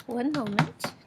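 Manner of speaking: ordinary delivery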